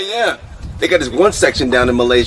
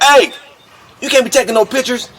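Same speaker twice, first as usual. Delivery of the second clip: customs officer yelling; 9 semitones higher than usual. Retold or imitated. Customs officer catches him taking pictures, higher mock bark. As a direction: snap at the tourist like a stern guard.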